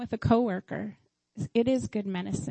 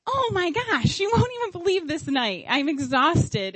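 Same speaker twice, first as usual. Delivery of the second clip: high start, sliding down wearily; dramatized venting, pitched up then slowing; acted exasperation; 6 semitones higher than usual. Mother venting to husband on the phone, acted exasperation.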